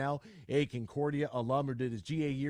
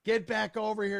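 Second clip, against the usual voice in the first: mock yelling wife; 8 semitones higher, 4 dB louder than usual. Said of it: "His wife is yelling at him".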